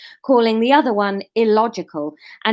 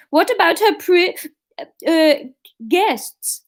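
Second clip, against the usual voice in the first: put-on Swedish accent; 8 semitones higher, 4 dB louder than usual. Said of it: "Oh, hang on, Swedish".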